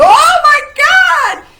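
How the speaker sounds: hammed-up terror at a curse; mock panicked shriek. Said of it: "and you go".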